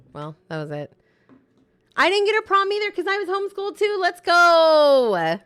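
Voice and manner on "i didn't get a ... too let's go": high, loud hype yell; hyped shout. Real, not acted. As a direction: cheer it out at full volume. Hyped reading of chat.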